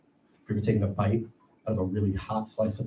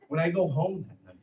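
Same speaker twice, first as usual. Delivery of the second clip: normal talking voice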